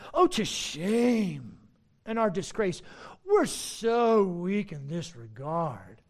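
slow, drawn-out mock lament; sarcastic. Preacher as Paul feigning shame, sarcastic. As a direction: stretch out the false confession of weakness. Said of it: "Again, the sarcasm".

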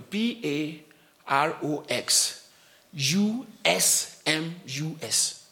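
letters spelled out slowly one by one; slow spelling. Shown as a demonstration. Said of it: "let me spell it for you".

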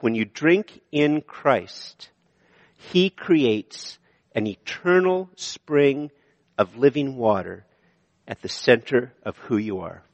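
very slow, word by word; deliberate sermon point; slow emphatic point. Shown as a demonstration. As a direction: spell out each word of the point. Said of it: "And the second point".

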